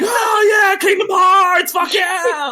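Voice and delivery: high-pitched rowdy mock cheer; wicked loud. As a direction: holler it like an overexcited fan. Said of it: "being wicked loud".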